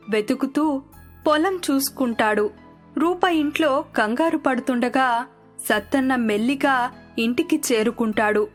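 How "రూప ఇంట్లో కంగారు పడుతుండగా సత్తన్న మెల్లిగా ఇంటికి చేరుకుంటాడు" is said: plain reading voice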